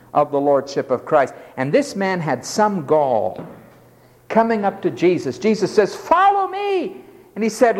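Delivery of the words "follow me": high slow commanding call. Retold or imitated. Jesus' call quoted.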